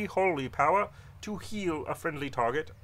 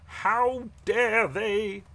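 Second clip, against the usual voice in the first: slow, high, louder indignation; drawn-out outrage; 8 semitones higher, 6 dB louder, much slower than usual. Acted. Paladin repeats his indignation at the beasts, slower and angrier. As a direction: stretch every word in disgust.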